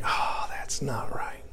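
slow, breathy whisper under the breath; a slow hushed whisper of private doubt; whispered aside. Retold or imitated. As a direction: whisper it slowly to yourself. Inner reaction to wrong counsel.

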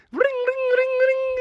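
very high trilling rings; phone ring imitation. Mother phones Doctor Venkman, imitated ringing.

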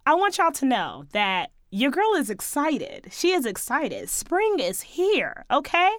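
ordinary delivery

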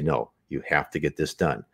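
low, soft, controlled insistence; calm firmness. Shown as a demonstration. Using indignation to get things done, calmly firm. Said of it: "you speak calmly".

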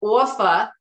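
"Awfa" for offer, drawn AW and dropped R; Jersey pronunciation. Shown as a demonstration. Daughter demonstrates mom's word, "Awfa" in Jersey accent. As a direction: say "Awfa" loudly, Jersey style, no R. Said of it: "I phonetically spell everything out".

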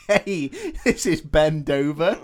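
loud, slow, high joke caller; mock phone introduction. Fake guitarist phoning a fan, loud mock introduction. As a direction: announce the gag name loudly and slowly.

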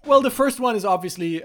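ordinary talk, nothing marked

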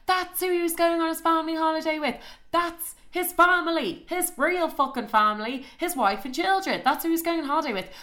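high-pitched indignant emphasis; scandalised outrage. Real, not acted. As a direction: spell out the betrayal, appalled. Scandalised at his real family holiday.

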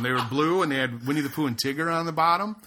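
ordinary talk, nothing marked

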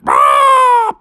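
high, loud storybook scream; hammed-up high "Ah!"; fishermen's scream. A narrator's reading. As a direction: scream as they leap overboard. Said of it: "they all went".

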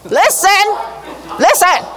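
loud, high commanding call; booming divine summons; God calling out. Acted. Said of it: "That's God going".

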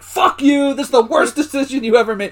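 high, loud indignant retort; mock angry outburst. D'Angelo cursing out Andy as retold, mock fury. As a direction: imitate a furious telling-off.

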